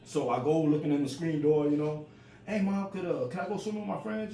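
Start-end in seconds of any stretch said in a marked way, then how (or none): 2.5-4.3 put-on higher-pitched voice of a boy asking; child asking mom. Kid asking mom to go swimming with friends, high-pitched request. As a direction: ask mom in a high, hopeful kid's voice.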